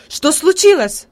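put-on a scared old woman crying out; frightened cry. A narrator's reading. Old grandmother startled by the crash, frightened cry. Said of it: "вскричала перепуганная старушка".

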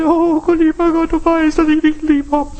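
high, sobbing, hammed-up plea to God; mock a weepy hypocrite praying; imitating a sister's tearful show prayer; mock whiny prayer. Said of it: "die hat geheult".

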